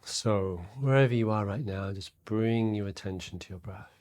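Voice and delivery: guided meditation. Real, not acted. Meditation teacher to listeners settling into their breath, soft and calm. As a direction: guide them inward quietly, leaving space between phrases.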